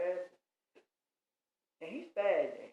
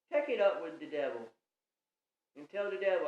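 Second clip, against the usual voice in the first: usual speaking voice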